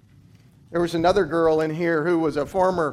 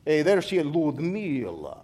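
Russian accent. Shown as a demonstration. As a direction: stretch Ludmila out like a Russian coach. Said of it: "I'd give it this nice Russian accent".

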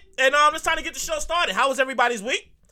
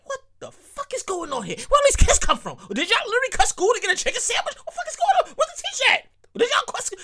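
high, incredulous questions; can't believe all these kids are here; shocked disbelief; 9 semitones higher than usual. Retold or imitated. Host retelling the crowded Popeyes, high incredulous questions. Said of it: "I was like".

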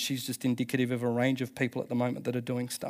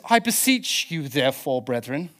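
lightly put-on English accent; a touch of mock-English recitation. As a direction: read it like old scripture in an English accent. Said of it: "gotta say it in an English accent".

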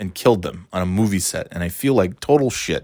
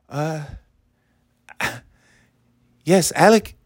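bad fake Spanish accent; 5 semitones higher than usual. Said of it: "I can't do a fake".

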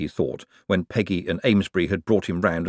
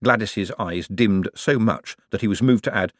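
ordinary delivery

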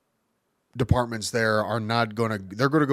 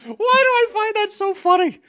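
high, loud, laughing disbelief; cracking up; 6 dB louder than usual. Real, not acted. Comedian tickled by own joke, high loud cracked-up voice. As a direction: squeal it through your own laughter. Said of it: "how am I cracking myself up?".

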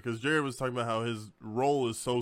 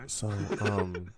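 lower, hesitant imitation of a humbled friend; mock sheepish; 4 semitones lower than usual. Friend imitating player returning minutes later, mock sheepish. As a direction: ease back in awkwardly after a sulk.

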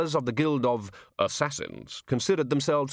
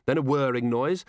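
usual speaking voice

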